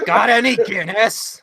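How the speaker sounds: slow, laughing put-on Irish lilt; mock Irish accent. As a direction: ham up a stage Irish accent through laughter. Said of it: "Even though I'm Irish".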